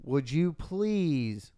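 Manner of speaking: very drawn-out, slow request; slow, imploring appeal; exasperated plea. Real, not acted. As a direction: stretch out the please in exasperation. Pleading with sister who won't answer.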